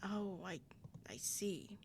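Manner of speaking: whispering; hushed whisper. Acted. Hushed reply in scene.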